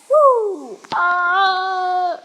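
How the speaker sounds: very high, loud whoop; thrilled yell; excited whoop. Real, not acted. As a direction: cheer it out at the top of your voice. Excited cheer mid-match.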